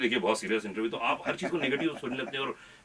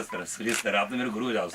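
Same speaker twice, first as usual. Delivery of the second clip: normal talking voice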